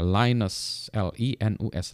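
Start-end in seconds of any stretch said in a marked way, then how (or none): 0.0-0.9 Linus as "lie-nus", first vowel like line; American pronunciation. Shown as a demonstration. Lecturer quoting how Americans say it, Linus as "lie-nus". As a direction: say Linus the American way, "lai-nes". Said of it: "Amerika bilangnya Linus".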